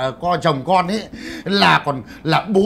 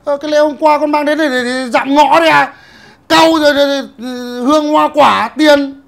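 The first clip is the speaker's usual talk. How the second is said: indignant listing; 7 semitones higher, 6 dB louder than usual. Acted.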